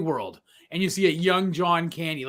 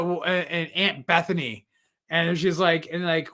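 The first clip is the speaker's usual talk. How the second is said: usual speaking voice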